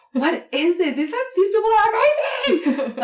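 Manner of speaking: high excited gush with a laugh; amazed. Retold or imitated. Recalling the comedians, starstruck high gush.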